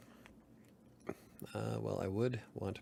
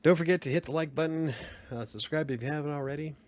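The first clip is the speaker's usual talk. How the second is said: higher, louder announcer-style plug; subscribe plug; 5 semitones higher, 5 dB louder than usual. Real, not acted. Streamer pitching viewers to like and subscribe, announcer style. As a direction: sell the like button like an ad.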